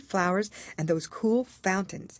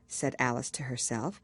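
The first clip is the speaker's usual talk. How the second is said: ordinary delivery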